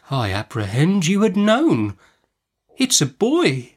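slow, tentative, rising high at the end; hesitant, deferential little man; meek doctor. A narrator's reading. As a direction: break the news timidly. Meek doctor timidly breaks the news.

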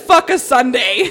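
loud, slowed mock outrage; retold pissed-off outburst. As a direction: imitate her cursing the day, loud and drawn out. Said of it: "She was so pissed".

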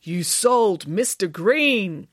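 very slow, drawn-out accusation; firm. A narrator's reading. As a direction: spell out the charge word by word. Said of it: "said Michael firmly".

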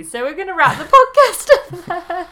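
words broken by high laughter; laughing while talking. Real, not acted.

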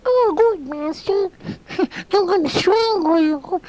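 tiny squeaky falsetto monster; very high, comically harmless monster voice; Frankenstein. Shown as a demonstration. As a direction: squeal the threat like a chipmunk. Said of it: "he sounded like this".

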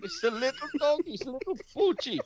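high gushing mock Putin; Russian accent. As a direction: coo over the doggy, Russian style. Putin voice gushing over dogs.

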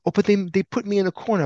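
high mock whine; mocking, high-pitched helpless protest; wimpy excuse. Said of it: "so wimpy".

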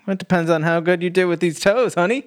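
put-on teasing Southern accent, half-hearted; bad Southern accent. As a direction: ham up an inconsistent Southern accent. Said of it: "just terrible and inconsistent Southern accent".

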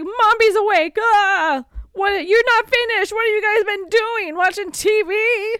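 high, loud scolding voice; mock angry witch. Mombi bursting in and scolding the kids, loud and high. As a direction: shriek at the kids like a furious witch. Retelling Mombi catching them.

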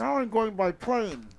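a hint of the silly viewer voice; Phoenix voice. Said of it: "That was my Phoenix voice".

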